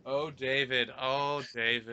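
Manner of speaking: lightly faked moaning of a name; mock bedroom acting. Co-host mocking an actress with her director, fake moaning. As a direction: moan it like a half-hearted actress. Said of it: "she's acting during the fucking also".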